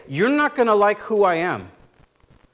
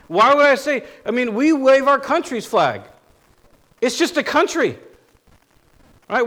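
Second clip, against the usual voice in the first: louder, higher fired-up preaching; rising sermon fervor; impassioned preaching; 4 semitones higher, 3 dB louder than usual. Acted. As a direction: build to a passionate pulpit cry. Impassioned flag-waving preaching.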